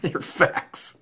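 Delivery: high, cracking up mid-phrase; laughing. Real, not acted. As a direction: laugh through the words, amused every time. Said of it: "makes me laugh every time".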